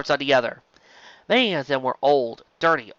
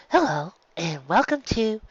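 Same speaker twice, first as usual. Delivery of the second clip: higher, slower, welcoming shop voice; bright cheerful shopkeeper; 6 semitones higher than usual. Acted. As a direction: greet the customer brightly. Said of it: "a bright, cheerful voice".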